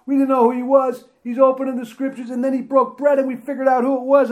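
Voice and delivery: high, breathless-paced rush of eyewitness news; excited retelling. Acted. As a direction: tumble through the story, high and eager. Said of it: "they're telling them".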